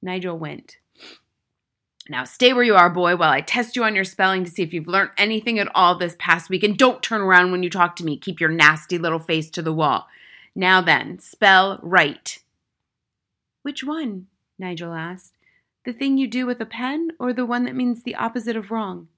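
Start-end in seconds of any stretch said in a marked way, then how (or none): 2.1-12.4 loud, threatening command; domineering schoolmistress shout; tyrannical headmistress, angry. A narrator's reading. 13.6-14.3 put-on high little-boy voice, rising; high, tentative schoolboy; small brave boy. A narrator's reading. 15.9-19.1 put-on high little-boy voice; high-pitched, unruffled schoolboy; small boy, reasoning. A narrator's reading.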